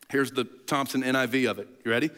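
ordinary talk, nothing marked

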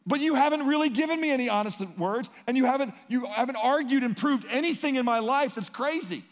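loud, high, indignant; Job's loud protest. Retold or imitated. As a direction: push back hard at the friends. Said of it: "He goes".

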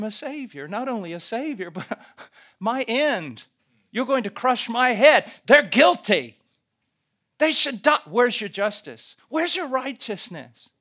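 loud, high-pitched railing at God; railing accuser. Acted. Accuser protests God promising a savior, railing loudly. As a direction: hurl each accusation, building hard. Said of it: "a railing in their voice".

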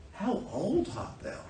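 high, soft, slow wonder; astonished question. Acted. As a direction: draw it out in hushed amazement. Voices Pharaoh's astonished question.

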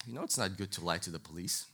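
low, quiet retold reproach; stern officer. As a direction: mildly imitate a stern officer, quick and low. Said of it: "comes back with this stern face".

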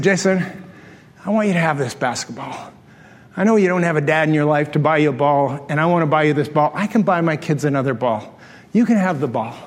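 low, tender offer; gentle sincerity. Retold or imitated. Tender gift to fatherless kid.

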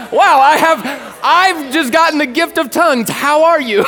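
high, loud, hammed-up wonder; mock amazement. Joking as someone just given the gift of tongues, amazed. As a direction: blurt it out big and high for laughs.

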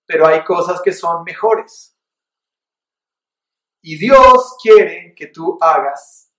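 ordinary delivery

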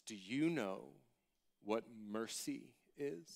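low, hushed and slow; solemn teacher question. Retold or imitated. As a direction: pose it softly, weighing each phrase.

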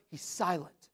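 soft, falling near-whisper; hushed. Acted. Preacher on your favorite philosopher having no answer, hushed. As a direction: let the voice drop away. Preacher drops voice to show silence.

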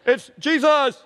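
high, loud announcing holler; acted call from afar. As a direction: shout who you are over the wind. Acting the call across water.

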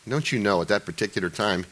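ordinary talk

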